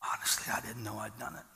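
soft whisper to himself; whispered thought. Retold or imitated. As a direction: let the thought slip out in a whisper. Retelling his own private thought.

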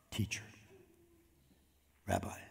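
hushed recognition. Retold or imitated. Mary's hushed reply on recognizing Jesus.

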